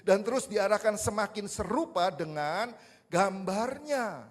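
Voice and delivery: loud, rising sermon build; preaching. Acted. Pastor preaching at full force to the church. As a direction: preach it out to the congregation.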